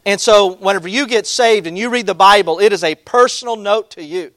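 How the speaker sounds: preaching at full force. Acted. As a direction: proclaim it to the whole congregation. Preacher opens on Scripture.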